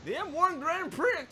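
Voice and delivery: mock announcer. Mocking announcer repeat.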